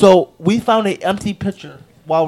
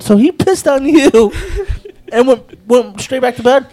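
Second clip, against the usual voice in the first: high, loud question broken by a laugh; laughing disbelief; 6 semitones higher, 5 dB louder than usual. Retold or imitated. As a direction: ask it while cracking up. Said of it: "I said".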